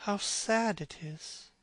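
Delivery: soft, slow murmur; a slow sorrowful murmur at the portrait. A narrator's reading. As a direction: breathe it out sadly, slowly. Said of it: "murmured Dorian Gray".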